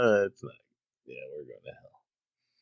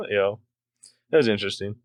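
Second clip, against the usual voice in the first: normal talking voice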